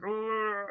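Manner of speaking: high, drawn-out mock groan; imitated strained "ugh"; pained groan. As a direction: groan as if holding in pain. Acting out silent pain.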